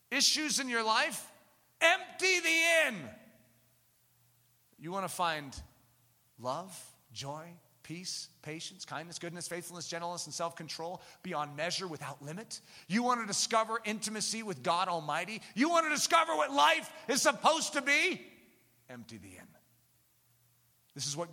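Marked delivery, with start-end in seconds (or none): 1.8-3.1 shouted refrain. Real, not acted. 18.9-19.6 very low, soft, almost a whisper; hushed refrain. Real, not acted. Preacher repeating the refrain softly to the listeners.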